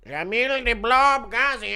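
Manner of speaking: high, slow, drawn-out put-on voice; Jabli. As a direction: stretch it out high in a comic imitation. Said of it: "Impression of Jabli saying Shamil the Blob".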